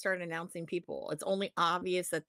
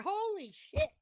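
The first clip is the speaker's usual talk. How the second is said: stunned exclamation; 11 semitones higher, 3 dB quieter, slower than usual. Retold or imitated. Fan spotting Josh Woods at the show, stunned exclamation. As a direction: shoot way up in pitch, caught off guard. Spotting a surprise wrestler live.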